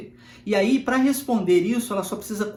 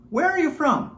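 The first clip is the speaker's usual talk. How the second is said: "Where are you from?" up high, stress on "Where"; higher natural question; 8 semitones higher than usual. Shown as a demonstration. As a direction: ask it again, higher and quicker. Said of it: "A pergunta que nós fazemos". (pergunta mais aguda, ênfase em "Where")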